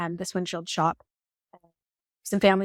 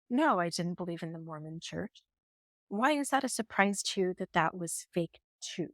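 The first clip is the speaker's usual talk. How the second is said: subdued retold confession; 6 dB quieter than usual. Ex-husband admitting to wife their life was fake, subdued. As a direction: retell it softly and heavily paced, like a confession.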